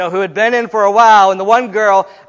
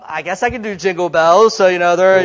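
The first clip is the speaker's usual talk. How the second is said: ordinary talk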